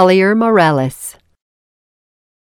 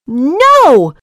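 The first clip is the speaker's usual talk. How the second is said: shouting pigs; 6 semitones higher than usual. A narrator's reading. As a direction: shout defiantly at the wolf. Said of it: "shouted the little pigs".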